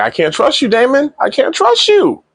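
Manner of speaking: imitated reproach climbing to very high pitch; mock reproach. Queen tells husband he is untrustworthy, climbing mock reproach. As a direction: start near normal, rise steeply into high exasperation.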